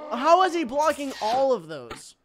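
high, loud, drawn-out disbelief; exasperated yell. Real, not acted. Gamer baffled by endless blocks, exasperated yell. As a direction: protest it loudly, stretching the words.

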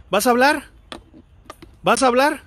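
loud, high-pitched challenge; demanding call. Real, not acted. Investigator challenging a spirit to speak, demanding loudly. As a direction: call out forcefully, demand an answer.

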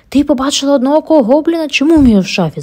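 high, loud eager question; ask, hoping for monsters; boy eagerly hoping for a goblin; hopeful question. A narrator's reading. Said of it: "запитав з надією Едгар".

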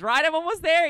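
excited. Real, not acted. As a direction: rush it out, thrilled. Excited, much higher voice.

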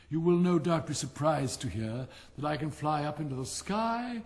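preen and show off, loving your own voice; pompous Rocket. Acted. Vain firework boasting to the silent Dragonfly.

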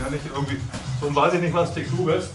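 ordinary talk

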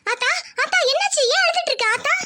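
high, worried daughter's plea; alarmed questioning. Acted. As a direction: ask anxiously why mother is crying. Daughter finds her mother crying. (பதற்றத்துடன் கேட்கும் குரல்)

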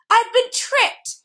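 very high, drawn-out cry; helpless cry. A narrator's reading. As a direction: wail it slowly in helpless dismay. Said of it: "cried the math magician helplessly".

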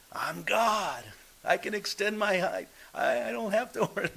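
mock boastful God. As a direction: puff up like someone playing God. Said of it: "Jesus isn't talking about that".

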